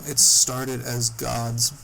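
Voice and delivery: slow, deliberate read from his notes; reading his own notes aloud, slowly. Shown as a demonstration. Friend reads out his trip notes to the group, slow and deliberate. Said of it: "me quick dictating how this was".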